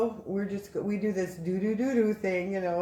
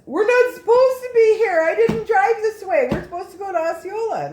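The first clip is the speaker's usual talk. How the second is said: high, loud imitation of a frantic friend; panicked protest; 14 dB louder than usual. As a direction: wail it out in reenacted alarm.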